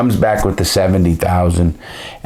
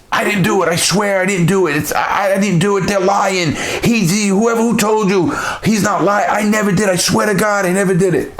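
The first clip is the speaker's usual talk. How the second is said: put-on much higher, frantic begging voice of the kid; retold begging, voiced far higher; mock pleading kid; 13 semitones higher than usual. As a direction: imitate a terrified young man swearing innocence, pitched way up. Kid pleading under interrogation.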